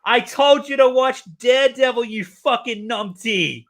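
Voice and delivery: loud, high exasperated yell, slowing right down on the insult. Retold or imitated. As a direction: yell it at a clueless friend who got it wrong. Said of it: "I facepalmed".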